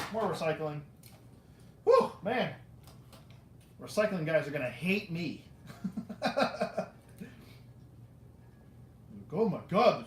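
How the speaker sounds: ordinary talk, nothing marked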